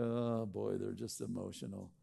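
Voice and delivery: dismissive husband. Retold or imitated. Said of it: "and you go".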